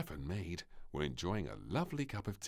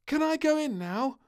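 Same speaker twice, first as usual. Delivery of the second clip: put-on very high, loud child voice; a girl bursting to jump in; eager little princess; 9 dB louder than usual. A narrator's reading. Princess asks the maid to swim, high and eager.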